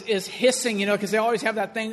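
ordinary delivery

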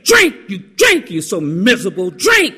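exasperated high shriek on each drink; fed-up yelling. Retold or imitated. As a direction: imitate a wife at the end of her rope, shouting. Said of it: "she was so fed up".

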